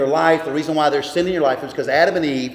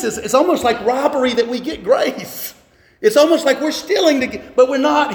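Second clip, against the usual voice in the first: rising wonder; 7 semitones higher than usual. Real, not acted. Preacher marvels at grace.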